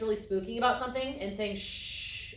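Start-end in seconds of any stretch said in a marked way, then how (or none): 1.5-2.3 whispered soft shush; soothing shush. Shown as a demonstration. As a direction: hush a spooked horse. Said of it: "petting and reassuring".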